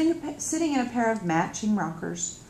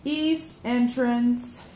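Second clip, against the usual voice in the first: correcting; 4 semitones higher, much slower than usual. Acted. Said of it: "she corrects me".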